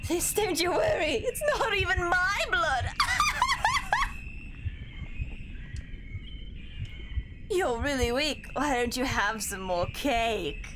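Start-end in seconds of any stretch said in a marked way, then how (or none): 2.9-7.4 shrill triumphant villain laugh; evil cackle. Acted. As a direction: cackle like a wicked witch mother. Game mother laughs madly.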